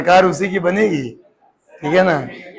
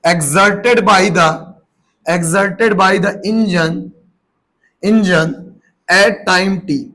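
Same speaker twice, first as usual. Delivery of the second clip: each word drawn out very slowly; teacher dictating a question, word by word; slow dictation; much slower than usual. Shown as a demonstration. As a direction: dictate slowly so they can write. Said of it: "लिख के सवाल".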